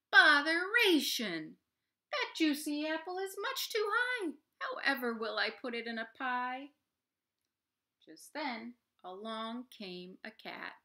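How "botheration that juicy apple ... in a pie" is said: high, loud exasperated cry of botheration; frustrated Mister Bingle. Acted. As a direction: wail it to the skies, fed up. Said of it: "Mister Bingle's lament".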